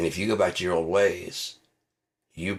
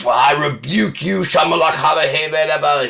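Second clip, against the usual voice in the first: loud, higher gibberish preacher; mock tongues preacher; 8 semitones higher, 8 dB louder than usual. Comic mimics televangelist speaking in tongues, loud and high. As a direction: rebuke like a frenzied preacher. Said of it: "he's speaking in tongues".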